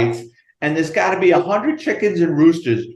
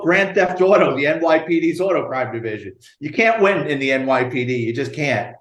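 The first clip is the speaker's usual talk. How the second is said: ordinary delivery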